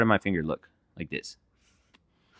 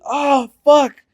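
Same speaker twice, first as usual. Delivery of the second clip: higher, louder shocked swear; shocked curse; 12 semitones higher, 8 dB louder than usual. Retold or imitated. Hearing the hamster hit the ceiling, higher louder shocked swear. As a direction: blurt it in alarm.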